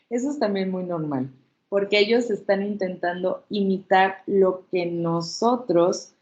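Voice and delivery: ordinary talk, nothing marked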